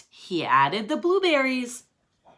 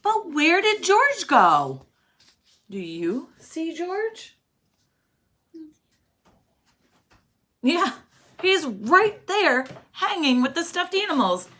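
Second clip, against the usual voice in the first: higher, brighter asking; playful guessing game; 7 semitones higher than usual. Shown as a demonstration. Reader asks children to spot hidden George, playful. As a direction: tease the kids to find him.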